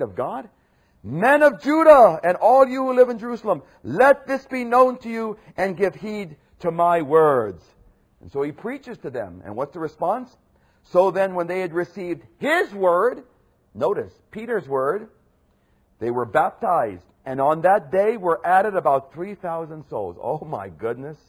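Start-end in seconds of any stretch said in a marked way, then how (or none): 1.0-7.6 high, loud proclamation, slowing at the end; raised voice, declaring. Acted. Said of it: "raised his voice and declared". 10.8-20.2 triumphant reading. Acted.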